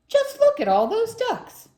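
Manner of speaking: high, loud storybook excitement; loud, high-pitched wonder; amazed exclamation. Acted. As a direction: exclaim it big and high for the kids. Character exclaims in read story.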